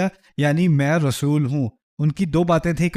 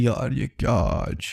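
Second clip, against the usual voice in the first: lower, slow, softer gossiping aside; amused guard aside; 7 semitones lower, 4 dB quieter, slower than usual. Retold or imitated. Security guard in the corner filming Pharaoh, lower amused aside. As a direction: mutter it like a guard filming on the sly. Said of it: "video بنا رہے ہیں،".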